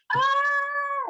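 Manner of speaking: high, held mock scream; drawn-out pretend shriek of surprise; surprised yell. Shown as a demonstration. Teacher imitating people who yell when surprised, mock scream. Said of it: "they're surprised, like".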